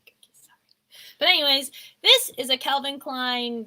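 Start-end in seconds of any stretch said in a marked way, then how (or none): 0.0-0.6 whispered, sheepish okay sorry; meek apology. Retold or imitated. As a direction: back down in a whisper. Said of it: "I was like".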